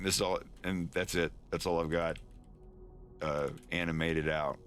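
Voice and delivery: ordinary delivery, nothing marked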